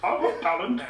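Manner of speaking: indignant rant. Acted. Conscience boasting about his talent show standing ovation. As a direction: protest his own talent.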